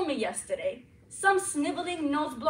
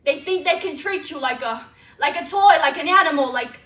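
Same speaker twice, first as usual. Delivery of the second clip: building into a loud angry rant; rising rant; 8 dB louder than usual. Acted. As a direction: push it louder and louder in anger. Ranting about abuse.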